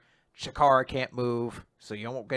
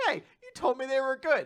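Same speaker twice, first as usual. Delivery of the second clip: mock protest; 11 semitones higher, faster than usual. Andy voiced, protesting.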